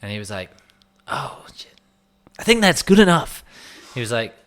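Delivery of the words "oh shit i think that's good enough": hushed mock American reaction rising to a high, loud verdict; American put-on, whispered then exclaimed; imitated American accent. American stranger reacts to the Scottish accent, imitated American exclamation. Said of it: "he had an American accent".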